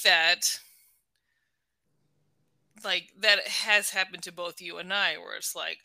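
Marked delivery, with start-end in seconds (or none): none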